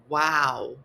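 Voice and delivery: ordinary delivery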